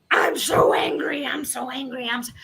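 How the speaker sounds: imitating an angry rant, first cry shouted; a mock shout of "angry" that falls away into a mutter; mock angry loop. Mimicking someone caught in an anger loop, mock shouting. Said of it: "they're on a loop-de-loop of".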